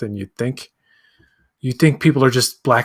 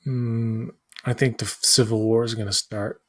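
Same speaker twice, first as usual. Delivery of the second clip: drowsy and quiet; nodding off; 2 semitones lower, 4 dB quieter than usual. Real, not acted. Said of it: "I'm falling asleep".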